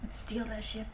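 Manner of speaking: softly whispered scheming; quiet, secretive planning, barely on the mic; hushed. Pretend. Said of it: "Don't forget you are speaking into microphones".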